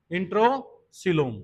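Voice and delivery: "इंट्रोसीलोम" drawn out slowly in two parts; slow, split repetition. Real, not acted. Teacher answers own quiz for students, "इंट्रोसीलोम" slow and split. Term repeated after quizzing the class.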